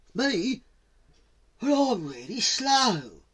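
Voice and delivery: slow, high tortoise voice; high-pitched, dragging reluctance; reluctant tortoise. Acted. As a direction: protest hesitantly, drawing it out. Tortoise protests being slow.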